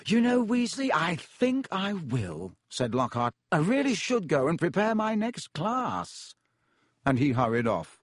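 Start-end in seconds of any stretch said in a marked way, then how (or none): 0.1-6.3 lightly voiced vain professor; pompous Lockhart. Lockhart, higher and slow.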